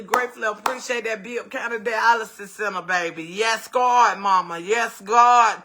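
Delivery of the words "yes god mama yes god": loud praise. Real, not acted. Host shouts praise for her mama's center. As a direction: holler thanks like at church.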